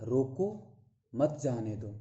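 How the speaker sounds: रोको set apart, short pause, then मत जाने दो as one phrase; pause after रोको. Shown as a demonstration. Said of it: "रोको के बाद कामा है". (रोको पर रुककर, फिर मत जाने दो)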